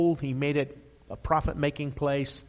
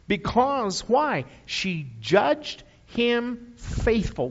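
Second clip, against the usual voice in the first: high, slow, drawn-out She judged him faithful; preaching climax; 7 semitones higher, 4 dB louder, much slower than usual. Acted. Preacher driving home his text, high slow proclamation. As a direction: lean on every word of judged him faithful.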